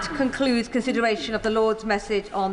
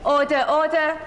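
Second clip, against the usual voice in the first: calling for order; 5 semitones higher, 6 dB louder than usual. Real, not acted. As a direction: raise the voice over the chamber. Speaker calls noisy House to order.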